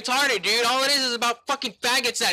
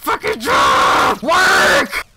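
raging scream that breaks; voice cracking; 5 dB louder, much slower than usual. Real, not acted. Gamer screaming at the controller to work, voice cracking. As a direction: scream it loud, voice splitting. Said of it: "Voice crack again!".

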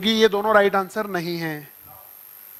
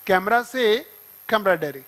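slower, stressing से; slow drilled repeat; slower than usual. Shown as a demonstration. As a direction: hammer the short mnemonic slowly. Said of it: "तो लिख दो".

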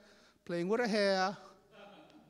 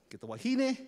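plain reading voice

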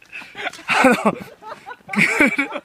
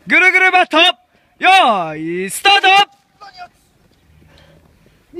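announcer call; 7 semitones higher than usual. Real, not acted. Announcing the second run.

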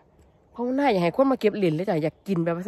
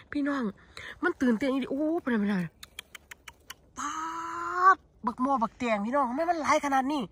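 excited; 5 semitones higher than usual. Real, not acted.

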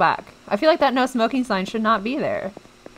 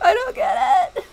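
whiny despair; 10 semitones higher, 3 dB louder than usual. Real, not acted. Despairing cry.

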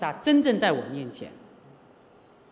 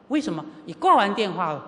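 usual speaking voice

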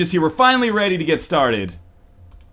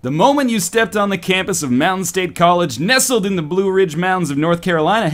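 lightly seductive narration; sultry story reading; 5 semitones higher than usual. Acted. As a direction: read it like an erotic novel. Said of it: "my best possible Fifty Shades of Grey voice".

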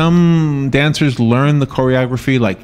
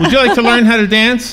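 higher playful invite; 7 semitones higher than usual. Real, not acted. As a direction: ask again, higher and coaxing. Host repeats invitation to passerby.

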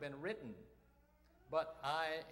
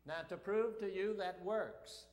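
usual speaking voice

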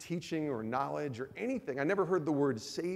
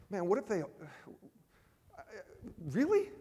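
incredulous; 7 semitones higher than usual. Retold or imitated. Inner reaction to wife's demand.

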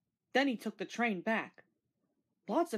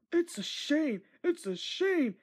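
hissed; 6 semitones higher, slower than usual. A narrator's reading. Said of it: "Henry hissed".

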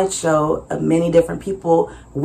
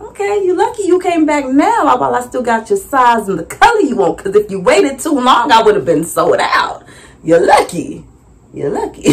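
sassy tease; 7 semitones higher than usual. Retold or imitated. Sassy teasing to the man.

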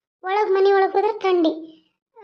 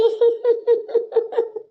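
sobbing; 6 semitones higher than usual. Acted. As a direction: break down crying for your mother. Grieving woman breaks down.

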